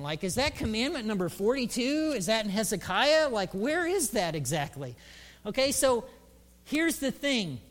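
ordinary talk

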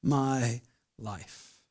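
soft, slow, fading surrender; quiet surrendering prayer; humble prayer. Retold or imitated. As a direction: give your life over, hushed and slow. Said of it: "a posture of saying".

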